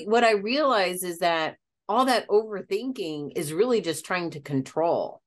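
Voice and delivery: normal talking voice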